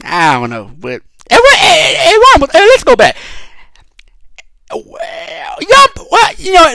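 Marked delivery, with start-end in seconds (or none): 1.3-6.7 hyped shouting interruption; excited outburst. Real, not acted. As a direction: burst out as the thought hits you. Excited outburst about [name].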